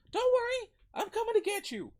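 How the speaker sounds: high, loud rescuing call; determined girl character. Acted. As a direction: call out high and urgent to reassure. Girl calls down to rescue him.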